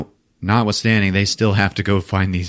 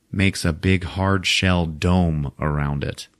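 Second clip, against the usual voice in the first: ordinary talk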